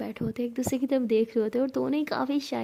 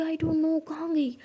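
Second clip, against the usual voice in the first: higher-pitched, mildly frantic; worried bewilderment; 5 semitones higher, slower than usual. A narrator's reading. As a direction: voice lifted, at a loss. Frantic search for missing book. (परेशान, उलझी आवाज़)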